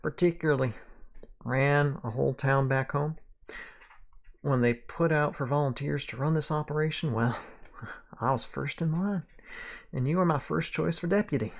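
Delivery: self-assured Southern sheriff; Southern accent. Acted. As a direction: play the old lawman with a Southern accent. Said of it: "I can do the accent".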